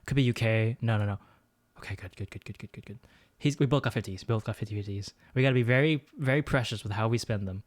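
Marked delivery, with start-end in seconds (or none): 1.8-3.0 fading murmur. Real, not acted. Fading self-talk.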